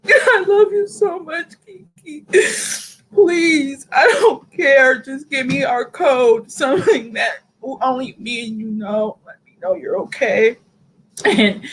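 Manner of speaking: pleading through real tears, voice high and breaking; crying. Real, not acted. A friend begs missing Kiki to call, crying. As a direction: sob out a plea to a missing friend. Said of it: "Take your time".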